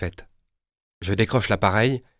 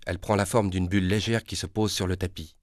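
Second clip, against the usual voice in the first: ordinary delivery, nothing marked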